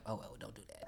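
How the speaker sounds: breathy whisper, catching himself; whispered retraction. Real, not acted. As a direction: whisper it as if taking it back. Jokingly taking back bad advice.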